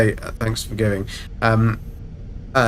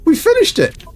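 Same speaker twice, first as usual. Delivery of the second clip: excited. Real, not acted. Celebrating finished expedition.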